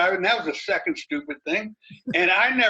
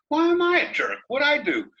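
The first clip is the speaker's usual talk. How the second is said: much higher, protesting innocence; indignant retort; 10 semitones higher than usual. Retold or imitated. Racer answering an angry rival, hammed-up indignant protest. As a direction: play the wronged guy, pitched way up. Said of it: "I said".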